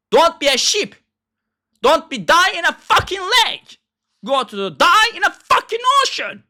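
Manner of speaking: high, scornful yelling; angry rant. Real, not acted. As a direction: lash out at the listener. Berating viewers as sheep.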